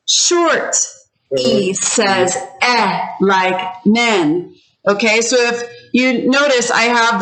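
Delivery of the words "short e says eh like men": very slow, each word set apart; repeat-after-me model. Shown as a demonstration. Teacher has students repeat the short E, slow repeat-after-me. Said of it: "you're gonna repeat after me".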